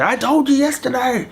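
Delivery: high exasperated mock snap; mock angry parent. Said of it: "You don't get angry and say".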